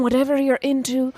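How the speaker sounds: ordinary delivery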